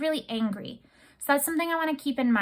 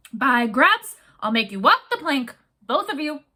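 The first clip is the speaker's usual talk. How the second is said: angry threat; 2 semitones higher, 5 dB louder than usual. Acted. Captain threatens the plank.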